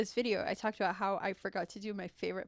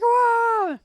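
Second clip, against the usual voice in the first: loud, high, drawn-out whoa; mock a roomful of startled guys; startled group shout; 14 semitones higher, 16 dB louder than usual. Guys react as mom walks in, startled shout. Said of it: "All the guys are like".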